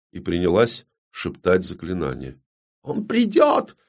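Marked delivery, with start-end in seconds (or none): 2.8-3.8 piercingly high, desperate squeal; shriek. Acted. Далина insists her rescuer will come, shrieking. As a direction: shriek it at the top of your range. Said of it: "завизжала Далина".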